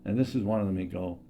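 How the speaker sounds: ordinary talk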